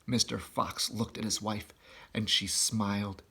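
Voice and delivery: plain reading voice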